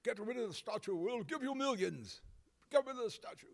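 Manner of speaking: high, pushy mock Russian accent, slowing on the bribe; a mock Russian accent, pitched well up; Russian impersonation. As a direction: play a Russian offering millions, in a hammed-up accent. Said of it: "That's my best Russian impersonation".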